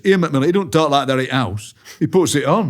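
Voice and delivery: mate's mother-in-law. Retold or imitated. Mate's mother-in-law accusing him of putting it on, mock complaint. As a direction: voice a mother-in-law's scornful claim. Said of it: "my mate's mother-in-law goes".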